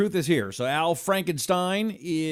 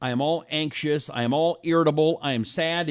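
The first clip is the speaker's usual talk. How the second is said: usual speaking voice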